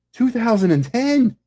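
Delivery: very high, drawn-out squeal of disbelief; high incredulous repeat. Real, not acted. Fan ranting about no franchise quarterback since then, incredulous high repeat. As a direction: repeat it in slow falsetto outrage.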